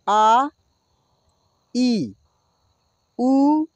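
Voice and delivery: ordinary talk, nothing marked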